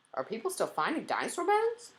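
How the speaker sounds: put-on high, loud child's question; schoolboy piping up with a question; curious boy. A narrator's reading. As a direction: ask eagerly, rising high at the end. Boy asks guide a question.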